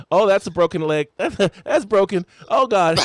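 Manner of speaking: loud, high mock-horrified outcry; hammed-up dismay, breaking into laughter; re-enacted alarm. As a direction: relive the shock of seeing the break.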